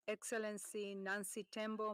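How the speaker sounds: usual speaking voice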